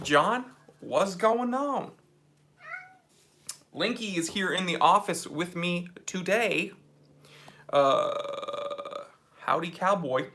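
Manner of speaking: ordinary talk, nothing marked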